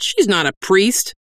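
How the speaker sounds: high-pitched protest; protesting cry. Acted. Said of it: "Lomo cried".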